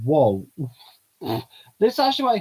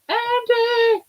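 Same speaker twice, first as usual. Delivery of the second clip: shouting name; 9 dB louder than usual. Acted. Girl character shouts.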